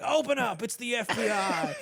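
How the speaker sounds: high, playful agent call-out; mock FBI raid. Dad re-enacting his son's car-door gag, high mock shout. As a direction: imitate a kid bursting in as the FBI. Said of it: "slam the door and be like".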